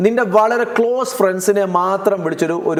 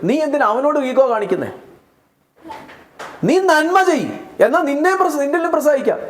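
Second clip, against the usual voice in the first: higher, urgent preacher voice; fervent preaching; 5 semitones higher, faster than usual. Acted. Preacher challenges the congregation about God's blessing, fervent and high. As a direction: press the question on the congregation.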